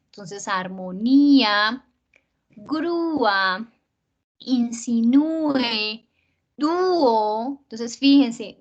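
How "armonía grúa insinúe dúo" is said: armonía, grúa, insinúe, dúo with the í or ú drawn out; stressed hiatus. Shown as a demonstration. Teacher showing the closed vowel breaking away, armonía, grúa, insinúe, dúo drawn out. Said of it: "siempre llevan tilde sobre la vocal cerrada".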